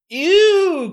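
high squealed ew; disgusted cry. A narrator's reading. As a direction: recoil in disgust. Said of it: "Katie cries".

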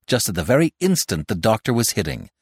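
usual speaking voice

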